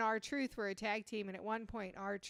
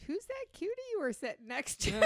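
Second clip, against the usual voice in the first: high-pitched mock-curious question; teasing quoted message; 9 semitones higher than usual. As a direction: read the friend's message way up high. Voicing friend's flirty message.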